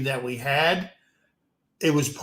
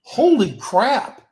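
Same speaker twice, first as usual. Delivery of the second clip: higher and slowed, drawn-out "Holy crap"; mock astonishment; 8 semitones higher, 4 dB louder, slower than usual. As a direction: act stunned by the accent, stretched out. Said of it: "you're just going".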